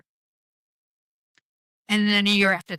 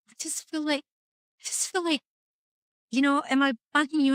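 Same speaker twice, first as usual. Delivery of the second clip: hesitant mom; 6 semitones higher than usual. Retold or imitated. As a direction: imitate a tentative mom, pitched up. Voicing mom's hesitant question.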